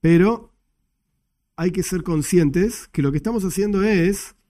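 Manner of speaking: ordinary talk, nothing marked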